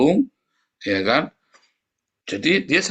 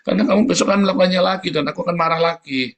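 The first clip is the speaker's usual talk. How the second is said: louder, exasperated warning to the wife; husband scolding wife, louder and exasperated; irritated warning; 5 semitones higher, 5 dB louder than usual. Retold or imitated. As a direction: lay down the law, fed up. Quoting himself warning wife angrily.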